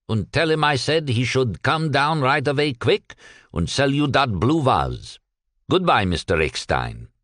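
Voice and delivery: put-on light Yiddish accent, easygoing and stalling; lightly voiced Yiddish shopkeeper. Cohn gives instructions on phone.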